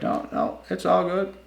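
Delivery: playing the cops as casual and dismissive; mock cop voice.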